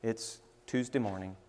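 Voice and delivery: soft, low thinking aloud; quiet musing to oneself, trailing along; inner thoughts. Retold or imitated. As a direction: murmur it like a private morning prayer. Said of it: "my mind starts going".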